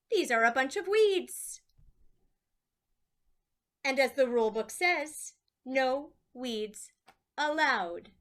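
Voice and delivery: loud, officious ruling; stern judge. Acted. Judges disqualifying Lucy's blooms, loud stern ruling. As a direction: lay down the rule firmly.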